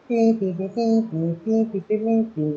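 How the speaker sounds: high, thin "pee pee" like a flute; teacher mimicking a wind instrument's high note; high piping whistle. Shown as a demonstration. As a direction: pipe it out like a little whistle. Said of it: "इस तरीके से आवाज़ होती है".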